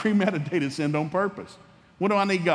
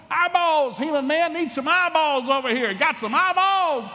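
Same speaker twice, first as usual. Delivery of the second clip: high, loud hammed-up street cry; mock yelling; 11 semitones higher, 4 dB louder, slower than usual. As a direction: holler like a blind beggar hawking for eyes. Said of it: "blind Bartimaeus starts screaming".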